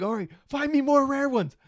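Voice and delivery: high and loud, hyped up; excited urging. Retold or imitated. Dad urging son to find rare cats, loud and high. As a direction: urge your son on, pumped. Voicing himself excitedly to kid.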